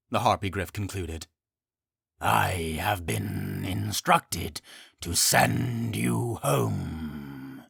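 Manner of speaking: slow, low grave creature; solemn and unhurried. Acted. Harpygriff speaks.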